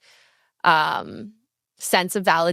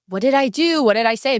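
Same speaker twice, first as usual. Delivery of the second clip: mock-anxious questions, higher in pitch; anxious self-questioning; 5 semitones higher, 4 dB louder than usual. Ghosted person looking in at themselves, mock anxious. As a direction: act out second-guessing yourself.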